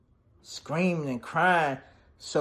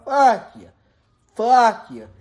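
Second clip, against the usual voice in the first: angry yell; 5 semitones higher, 6 dB louder than usual. Retold or imitated. Eli angrily cursing at Danny.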